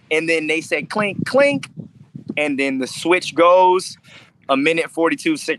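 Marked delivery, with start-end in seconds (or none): 0.9-1.7 two high-pitched clinks; imitated metallic clink. Fighter recalling the moment before the fight starts, high imitated clinks. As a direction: mimic a clink with the voice.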